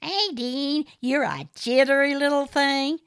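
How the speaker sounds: high, loud smarmy gentleman voice; fawning over Aideen, the smarmy caller; Morris, oily suitor. A narrator's reading. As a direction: purr it like a pushy suitor.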